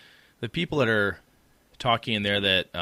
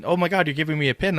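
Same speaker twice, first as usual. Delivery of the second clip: higher, quick, imitating a flustered fan; 8 semitones higher, 6 dB louder, faster than usual. Imitating a flustered listener given a pin, quick and higher. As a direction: mimic her breathless surprise over a gift. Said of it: "she was all, like, flustered".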